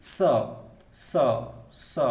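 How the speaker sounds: स said with a sharp, audible hiss of friction; isolated स sounds that show friction; hissed sibilant. Shown as a demonstration. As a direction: push air through the teeth on each स. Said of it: "आपका घर्षण हो रहा है".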